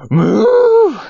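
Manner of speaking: high, loud angry cow moo. Pretend. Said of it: "Make it an angry moo".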